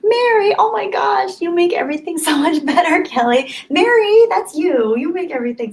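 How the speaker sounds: high, bright squeals of delight; excited gush. Real, not acted. Streamer squeals thanks to a viewer, excited. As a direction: gush over a fan with joy.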